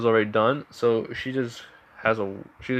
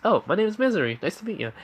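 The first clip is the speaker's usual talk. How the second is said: high-pitched friendly introduction; girl introducing herself; 9 semitones higher, faster than usual. Acted. Misery introduces herself to Katsuki, high girlish greeting. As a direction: lift the voice, softening at the end.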